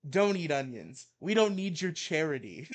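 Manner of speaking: heated mock-angry reading; ranting article read. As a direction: read it like a furious rant. Reads Maddox rant aloud.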